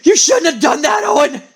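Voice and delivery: high, raspy angry outburst; exploding threat. Acted. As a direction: let the threat burst out high and rough. Same threat, now bursting out.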